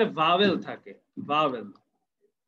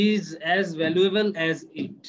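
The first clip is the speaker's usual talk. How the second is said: slow dictation; much slower than usual. Shown as a demonstration. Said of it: "কি বলবো?".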